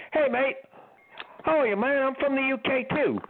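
put-on cheery English stranger saying hello, English accent. A stranger from the UK walks into Keith's room, light English accent. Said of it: "with an English accent".

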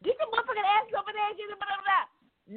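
high, fast, furious mock yell; mom yelling. Mom blowing up at her sons, high fast yell. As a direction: imitate the mom finally losing it. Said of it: "when she went to fuck off".

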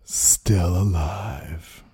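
slow, low spooky storyteller voice. Pretend. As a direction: draw it out like a ghost story. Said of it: "It's my spooky voice".

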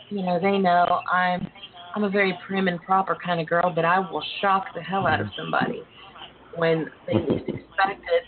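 ordinary delivery

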